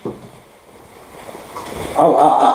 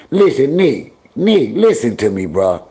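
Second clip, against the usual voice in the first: normal talking voice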